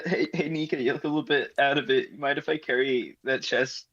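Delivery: ordinary talk, nothing marked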